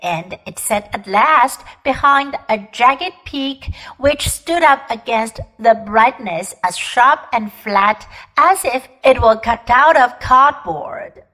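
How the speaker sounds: plain reading voice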